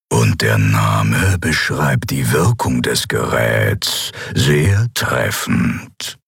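low, drawn-out villain voice; unperturbed emperor. A narrator's reading. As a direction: savor each word with unruffled menace. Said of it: "erklärte der Kaiser Endriel unbeirrt".

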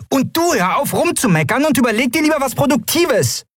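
very high, small character voice; scolding Nelen. Acted. Nelen ordering Keru to stop grumbling, high scolding. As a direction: tick him off, pitched very high.

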